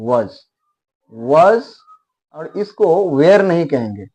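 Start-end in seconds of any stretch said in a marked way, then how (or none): 0.1-1.6 was said with a short, clear vowel; correct was. Shown as a demonstration. Teacher repeats the right way to say was. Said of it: "इसको was कहेंगे".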